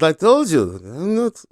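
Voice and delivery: a clumsy, lightly put-on Dutch accent, rising high at the end; half-hearted Dutch accent for Max's radio reply; terrible Max Verstappen accent. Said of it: "that's just such a terrible accent".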